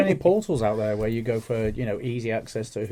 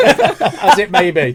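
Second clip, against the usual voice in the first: laughing; 8 semitones higher, 13 dB louder, much slower than usual. Real, not acted. Laughing after joke.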